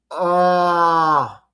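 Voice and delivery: long, level "ahh" of frustration; pained, held-out groan; exasperated groan. Real, not acted. Exasperated at garbled word.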